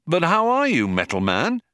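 high-pitched, lively hail; hearty greeting. A narrator's reading. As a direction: greet a gloomy friend with high energy. Ford greets robot brightly.

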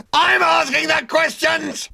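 very high, loud, slow shout; officer losing his temper; furious howl. Acted. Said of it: "howled number two".

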